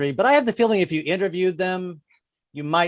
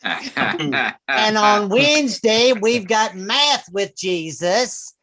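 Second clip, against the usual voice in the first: radio preacher announcing lineup; 2 semitones higher than usual. Acted. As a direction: imitate a folksy evangelist radio host. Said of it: "they give you the weekly lineup".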